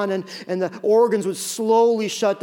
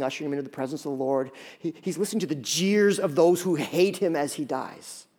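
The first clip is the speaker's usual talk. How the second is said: ordinary talk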